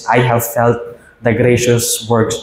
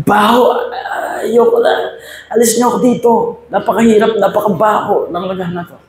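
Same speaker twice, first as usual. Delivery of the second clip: high, complaining whine; whining teacup; 10 semitones higher, faster than usual. A narrator's reading. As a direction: beg to be taken out, pained. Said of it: "sabi niya". (mataas at reklamong pag-ungol)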